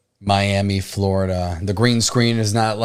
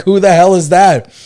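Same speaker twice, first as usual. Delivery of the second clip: much higher and louder disbelief; incredulous laughing; 8 semitones higher, 7 dB louder than usual. Retold or imitated. Reacting to dad's mangled guest name, laughing high. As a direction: howl it out in amused shock. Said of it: "I was like".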